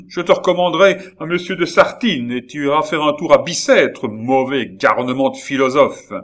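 high, loud threatening scold; angry threat. Acted. Baron threatening Gilbert with prison, loud angry threat. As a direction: spit the insult at the end.

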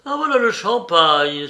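excited exclamation, loud and lifted; exclaimed. A narrator's reading. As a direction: call it out at the sight of champagne. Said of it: "s'écria Rakitin".

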